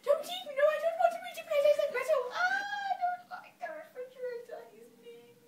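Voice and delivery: very high, wailing mock-terrified squeal; high-pitched tearful tantrum, dropping away at the end; frightened pleading. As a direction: squeal and whine like a child begging not to eat metal. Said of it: "the refrigerator was telling me that it didn't want to be eaten".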